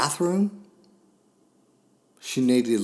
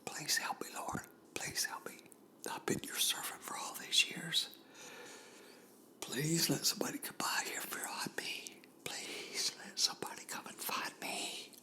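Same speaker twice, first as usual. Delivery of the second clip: breathless, whispered pleading to God; whispered prayer; 6 semitones higher, 14 dB quieter than usual. Acted. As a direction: whisper the prayer as if too weak to speak. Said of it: "she's crying out to Jesus".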